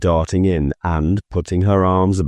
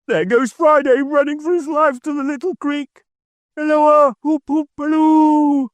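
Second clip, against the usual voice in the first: calling out. A narrator's reading. Scrooge cheering on Friday, high calling halloo. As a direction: call across to the creek.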